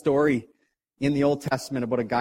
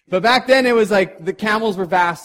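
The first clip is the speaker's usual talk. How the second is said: louder, higher, awed emphasis; awed emphasis on the camel herds; emphatic; 5 semitones higher, 7 dB louder than usual. A narrator's reading. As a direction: push the size of the horde. Dramatic emphasis on vast numbers.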